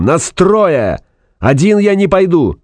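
high-pitched emphatic shout; barked refusal. A narrator's reading. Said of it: "гаркнул Максим". (решительный крик)

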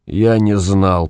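ordinary delivery, nothing marked

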